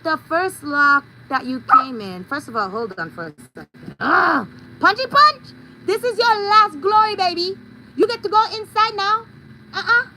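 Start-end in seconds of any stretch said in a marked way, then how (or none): 4.0-10.1 excited call to pet. Real, not acted. She urges her pet Punchy inside, excited baby talk. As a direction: cheer the pet on eagerly.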